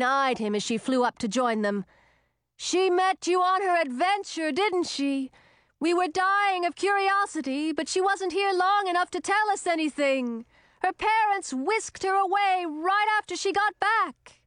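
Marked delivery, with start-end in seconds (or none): 2.6-14.4 put-on young witch voice. A narrator's reading. Young witch Chlorine speaking.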